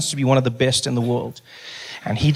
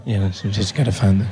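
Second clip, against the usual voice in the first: low, confiding hum; confidential voice; 6 semitones lower than usual. Shown as a demonstration. Like people in the office, low confidential voice. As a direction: keep it low and private, trailing along. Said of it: "That's a confidential voice".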